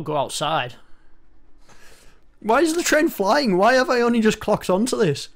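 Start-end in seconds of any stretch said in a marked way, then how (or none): 2.4-5.3 much higher and louder, baffled; incredulous outburst. Real, not acted. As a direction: suddenly realize the absurdity, loud. Noticing a flying train.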